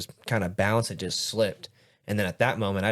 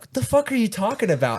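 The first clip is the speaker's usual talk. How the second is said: high-pitched, indignant retold confusion; acted disbelief; 12 semitones higher, 4 dB louder than usual. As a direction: replay being totally baffled by a friend. Retelling his reply to pepperoni remark.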